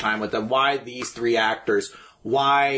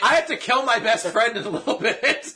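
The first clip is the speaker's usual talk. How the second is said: laughing mock voice; 9 semitones higher than usual. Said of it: "voicing De Niro in car".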